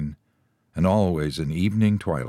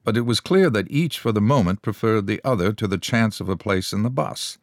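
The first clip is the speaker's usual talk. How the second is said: ordinary delivery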